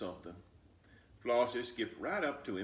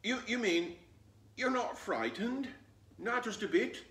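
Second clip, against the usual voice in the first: higher, louder, disbelieving; shocked fox; 12 semitones higher, 4 dB louder than usual. Acted. Fox asking Flossie if she's not scared, astonished. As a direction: ask in disbelief.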